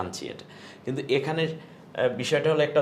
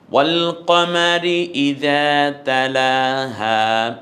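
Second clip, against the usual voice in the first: Quran recitation; 5 dB louder, much slower than usual. Shown as a demonstration. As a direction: intone each word long like a qari. Continues Surah Shams recitation.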